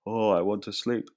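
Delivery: a lightly voiced sleepy man; tired Paul. Teacher voicing tired Paul, lightly sleepy. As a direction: sigh it out like someone worn out.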